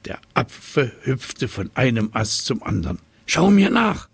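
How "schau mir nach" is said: high, louder monkey chatter; monkey chattering at the giraffe. A narrator's reading. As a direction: call it out like an excited monkey. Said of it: "schnatterte er".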